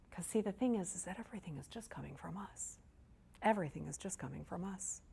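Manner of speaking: very soft, as if letting them in on a secret; near-whispered key point; hushed confiding. Real, not acted. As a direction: drop almost to a whisper.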